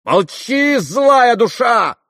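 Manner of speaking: very high, loud, drawn-out fury; enraged, piercing cry; furious shout. A narrator's reading. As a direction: scream at him to silence him. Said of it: "яростно крикнула ему Грушенька".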